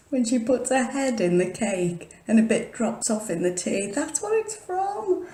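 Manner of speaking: high, bright excited rush. Real, not acted. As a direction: blurt it out thrilled. Excited recollection, laughing.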